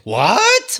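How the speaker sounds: high sharp "what"; shocked little question; surprised. Retold or imitated. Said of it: "I'm like".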